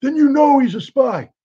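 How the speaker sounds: very high, loud accusation; shouting interrogator. Retold or imitated. Agent shouting at Marina during hostile polygraph, loud high accusation. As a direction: nail her with it.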